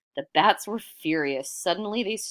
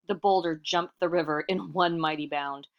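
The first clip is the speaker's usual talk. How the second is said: ordinary delivery, nothing marked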